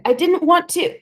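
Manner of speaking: high, panting cry; panicked. Acted. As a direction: gasp it out in terror. Panting boy after shout.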